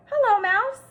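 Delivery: high, loud, kind elephant voice; Elliot the elephant. A narrator's reading. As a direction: call out gently to the little mouse. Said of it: "asked Elliot".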